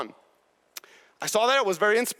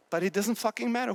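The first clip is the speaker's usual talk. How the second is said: normal talking voice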